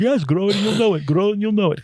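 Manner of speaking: high, odd-voiced repeated slogan; mocking Alan's pitch. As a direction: parody his awkward English pitch. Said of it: "You're like".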